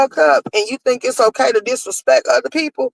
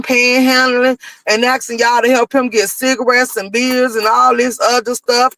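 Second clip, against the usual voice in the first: usual speaking voice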